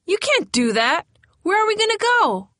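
high, loud, frightened pleading; a scared girl crying out at the officer; panicked plea. A narrator's reading. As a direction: beg in rising panic as the house is taken. Eviction, Vicky panics at officer.

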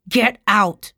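loud, drawn-out furious order; roared. A narrator's reading. Tara throws her uncle out, furious roar. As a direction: roar the command. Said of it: "Tara roared".

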